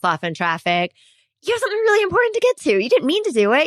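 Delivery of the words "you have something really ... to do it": high, rushed self-justifying excuse; excuse-making driver. Acted. Driver excusing cutting someone off in traffic, high and hurried. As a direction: plead your case quickly, pitched way up.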